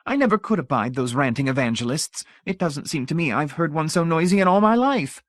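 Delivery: high, fussy matron voice; prim lady tutting at noise; society lady. A narrator's reading. Mrs Pritchett voiced.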